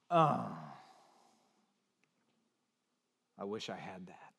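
low, very quiet envious sigh; wistful envy. Acted. Preacher mimicking someone jealous of another's Instagram, wistfully envious. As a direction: act out a deflated, envious murmur.

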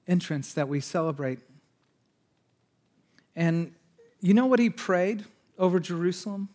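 usual speaking voice

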